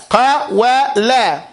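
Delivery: slow, stretched Qawala; drawn-out pronunciation. Shown as a demonstration. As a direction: spell Qawala out syllable by syllable. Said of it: "it's from the root qawala".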